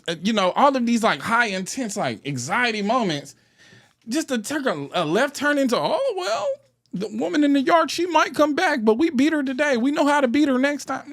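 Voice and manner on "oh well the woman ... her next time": much higher, sing-song mock cheer. Sneering at the film's happy ending, mock cheerful and high. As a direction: parody the cheesy upbeat ending. Mocking the movie's hopeful ending.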